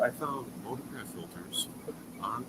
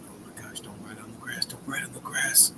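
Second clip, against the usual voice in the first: whispering. Retold or imitated.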